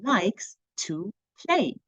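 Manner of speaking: slow, sing-song classroom prompt; slow teacher voice. Shown as a demonstration. Teacher to pre-K kids, slow and drawn out. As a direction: draw each word out for little ones. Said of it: "So we'll say".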